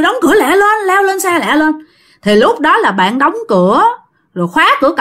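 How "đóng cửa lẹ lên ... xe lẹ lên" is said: urgent. Retold or imitated. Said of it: "ổng kêu".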